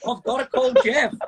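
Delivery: high, jubilant chanting; triumphant. Retold or imitated. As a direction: replay Greg's gleeful celebration. Greg jumping up and down.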